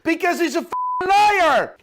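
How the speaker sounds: exasperated fury, voice climbing sharply; angry outburst. Real, not acted. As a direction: rant building to a loud, high yell. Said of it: "I'm just getting emotional and angry".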